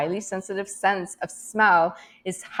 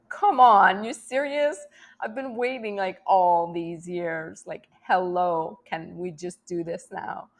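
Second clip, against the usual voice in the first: exasperated impatience; 3 dB louder, slower than usual. Acted. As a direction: plead impatiently, drawing out the middle words. Acted impatient plea about waiting.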